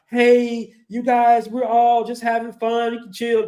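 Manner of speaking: smooth things over with everyone; mock peacemaker. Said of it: "she's all kumbaya".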